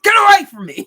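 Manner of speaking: sudden high, very loud yell; explosive mock shout; imitated angry scream. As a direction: scream it like a furious dad. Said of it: "the dad screamed at him".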